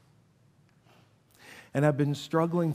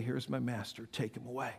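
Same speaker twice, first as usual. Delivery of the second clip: hushed betrayal; 4 semitones lower, 13 dB quieter than usual. Retold or imitated. Judas betraying with a kiss.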